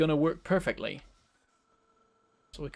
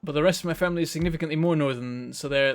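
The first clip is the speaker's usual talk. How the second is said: normal talking voice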